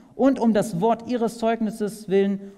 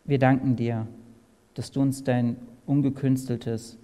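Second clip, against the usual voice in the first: lower, reverent prayer voice; 5 semitones lower than usual. Real, not acted. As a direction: address God humbly. Leads closing prayer.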